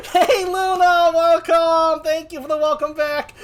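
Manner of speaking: high, loud drawn-out welcome; excited greeting. Real, not acted. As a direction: hail a friend with big energy. Greets a viewer joining.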